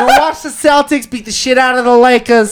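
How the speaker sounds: mock Boston sports fan, laughing; laughing Boston accent. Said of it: "it's a Bostonian accent".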